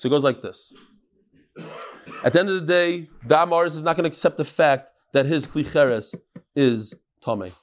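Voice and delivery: normal talking voice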